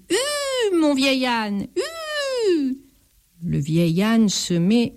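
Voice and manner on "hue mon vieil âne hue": high calls to the donkey; shouted giddyup. A narrator's reading. The thief urges his old donkey forward, calling high. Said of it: "lui crie".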